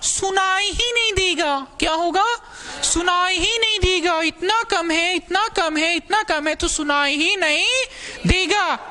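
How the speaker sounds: very high, sustained sing-out voice; पतली ऊँची आवाज़. Shown as a demonstration. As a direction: push it way up high and hold it there. बहुत कम आवाज़ का अभिनय. (ऊँची, खिंची हुई आवाज़ में)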